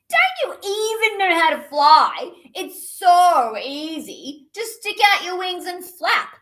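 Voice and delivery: high, loud scornful pigeon voice; rude pigeon. Acted. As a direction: talk down to them.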